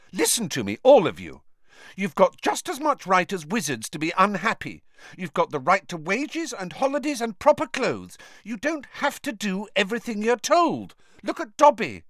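rousing, a touch loud; indignant lecture. A narrator's reading. Hermione urges the elves to claim their rights, indignant.

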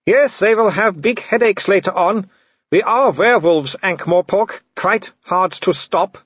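high-pitched gloating villain; Wolfgang. Acted. Werewolf boasting to the man in the tree, high gloating call. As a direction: boast loudly to cornered prey.

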